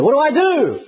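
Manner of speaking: high, anxious plea upward; hammed-up panic of a man slipping; pleading question. Dangling man asking the voice, high mock panic. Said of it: "And he said".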